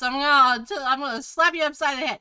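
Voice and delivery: loud, high, fast mock threat; hammed-up angry dad. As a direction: jokingly threaten a slap. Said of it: "He's joking".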